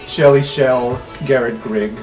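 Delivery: normal talking voice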